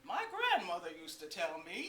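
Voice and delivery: goofy swoops from really high to down low; loud lilting highs and lows; Eugene. Retold or imitated. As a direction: swing the pitch high and low, playfully. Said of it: "would be really high and down low".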